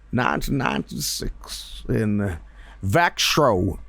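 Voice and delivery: lightly voiced old prospector, slow; a hint of a grizzled gold miner. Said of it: "We got an old prospector guy".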